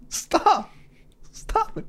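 tiny high, soft whimper; hammed-up feeble protest; pleading Earth. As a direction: plead weakly in a small high voice. Voices the feeble suffering Earth.

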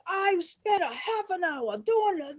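high, loud, stretched-out complaint; exasperated yelling. Retold or imitated. Director screaming about his wasted half hour, exasperated. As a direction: rant loudly, dragging the words. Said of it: "Lloyd starts screaming".

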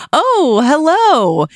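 high, sing-song "oh, hello"; chirpy doorstep greeting; sweet high greeting. Retold or imitated. People greeting trick-or-treater warmly.

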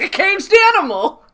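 high, loud, over-the-top warning; mock incredulity. Sam warns Oksana about Michael Weston, hammed-up incredulous. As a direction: cry it out in hammed-up alarm. Acting out Sam's incredulous rant.

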